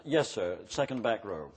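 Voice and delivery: ordinary talk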